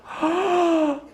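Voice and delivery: high falling gasp of alarm; mock gasp of shock; a high startled intake of breath. Acted second gasp.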